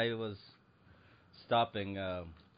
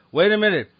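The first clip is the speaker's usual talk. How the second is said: loud, high complaining customer; indignant yelling about the sale price; angry shopper; 9 semitones higher, 11 dB louder than usual. Retold or imitated. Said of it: "The way people get ornery about".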